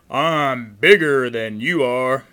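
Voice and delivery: very loud, bold big goat; booming goat boast. A narrator's reading. Great Big Billy challenges the troll, booming boast. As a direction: boast at full volume.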